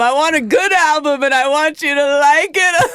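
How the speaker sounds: high-pitched hammed-up begging; mock desperate plea. Comedian begging listeners to like her album, mock desperate plea. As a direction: plead as if on the verge of mock tears.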